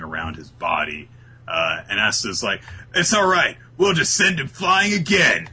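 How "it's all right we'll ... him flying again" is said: higher and louder, cheerfully reckless; playful imitation of an overeager hero; mock brash hero. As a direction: brush off the danger like a cocky kid. Voicing Asta's confidence.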